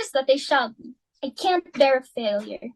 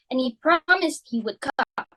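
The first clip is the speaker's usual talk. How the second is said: ordinary talk, nothing marked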